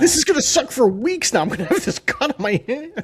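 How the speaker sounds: high-pitched, hammed-up whine; whiny complaining. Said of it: "just be like".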